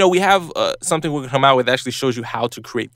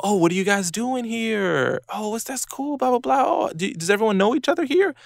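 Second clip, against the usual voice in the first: friendly curiosity; 9 semitones higher than usual. Retold or imitated. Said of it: "you know".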